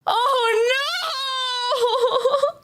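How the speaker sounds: dismayed cry. Real, not acted. Streamer reacts in dismay.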